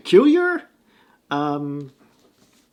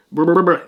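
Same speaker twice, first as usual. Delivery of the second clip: loud, quick "brr" noises; tongue-tied burbling; 2 semitones higher, 6 dB louder than usual. Real, not acted. Host mocking his own fumbled English, loud burbling. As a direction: shake out the tongue with burbled brrs.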